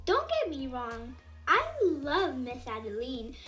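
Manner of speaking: plain reading voice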